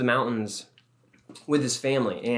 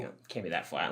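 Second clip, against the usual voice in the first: inner thought; 6 semitones lower, 10 dB quieter than usual. Retold or imitated. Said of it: "in my head, I'm thinking".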